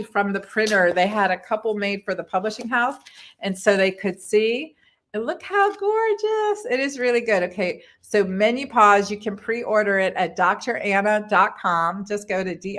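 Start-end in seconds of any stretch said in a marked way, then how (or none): 5.1-7.4 gushing delight. Real, not acted. Showing off her new book.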